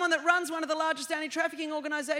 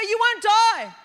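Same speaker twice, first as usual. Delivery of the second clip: high, loud taunting voice; loud devil taunt; 6 semitones higher, 8 dB louder, slower than usual. Retold or imitated. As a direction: shout the devil's promise. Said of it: "the devil will say".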